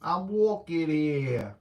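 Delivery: put-on slow New York accent; drawn-out New York tough guy. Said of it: "something that I would do with a New York accent".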